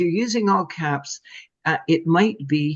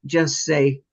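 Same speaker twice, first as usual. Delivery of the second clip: ordinary delivery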